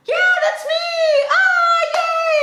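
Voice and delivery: very high, loud squealing yay; excited cheer. Retold or imitated. Her giddy reaction when her graduation is announced. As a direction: squeal with glee. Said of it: "I was like".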